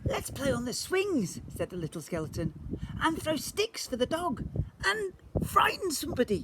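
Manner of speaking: high, bright-eyed little skeleton voice. A narrator's reading. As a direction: pitch it up for the small skeleton.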